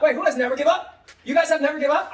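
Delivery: loud, high, laughing question; excited game host. Shown as a demonstration. Teacher asks who holds the card, excited. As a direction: call it out high and loud to the class.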